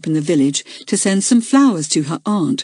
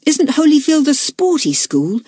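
plain reading voice

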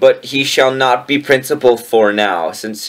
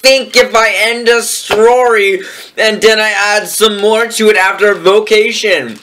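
theatrical author's note; 6 semitones higher than usual. Acted. Reader performs the author's note to readers, theatrical declamation. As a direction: proclaim it like a hammed-up challenge.

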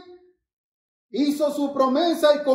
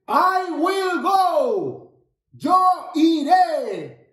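rallying call; much slower than usual. Shown as a demonstration. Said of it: "Siempre debes decir".

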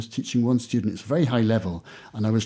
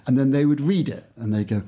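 ordinary talk

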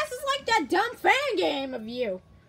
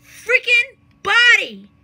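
"freaking body" slow, high and loud; drawn-out, loud stress on "freaking body"; emphatic warning; 5 semitones higher, 10 dB louder, slower than usual. Pretend. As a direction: punch "freaking body" word by word. Warns nobody to mess with them.

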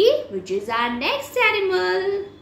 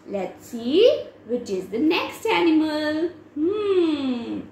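ordinary delivery

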